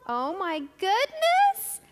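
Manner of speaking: big slow gasp of surprise; exaggerated wonder for little kids; surprised. Teacher feigns surprise for children.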